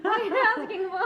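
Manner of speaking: high, loud mock-baffled question; retold bafflement. Retelling customs confusion with her husband, loud mock bafflement. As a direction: blurt it out in exaggerated confusion, half laughing.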